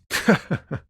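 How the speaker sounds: short teasing chuckle; amused little laugh. Acted. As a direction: laugh lightly in quick little bursts. Teasing the cop about someone missing.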